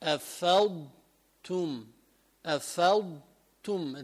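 أفضتم slowly, with a clear ض before the ت; careful model of أفضتم; correct, slow. Shown as a demonstration. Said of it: "لا، هي". (نطق صحيح متأنٍّ لأفضتم)

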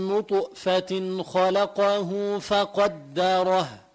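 very slow chanted نطفة خلقه فقدره with echoing stops on ط and ق; slow tajweed chant bouncing the ط in نطفة; qalqala recitation. Shown as a demonstration. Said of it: "هذه اسمها القلقلة".

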